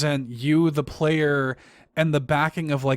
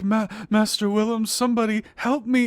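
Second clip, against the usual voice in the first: high pleading cry for help, voice lifted; Gehrman pleading; 7 semitones higher than usual. Retold or imitated. Gehrman whimpering for Master Willem in his sleep, high and pleading. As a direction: plead for Willem like a broken old hunter. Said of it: "He, he's like".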